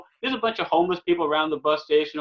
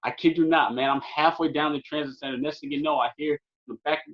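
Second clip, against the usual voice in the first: ordinary talk, nothing marked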